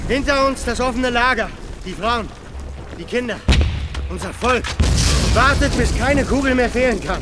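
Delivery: loud, high commanding address to the troops; rallying shout. Acted. Leader shouts to his men to hold fire, rousing. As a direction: rouse them, shouting over battle. Leader rallies defenders before assault.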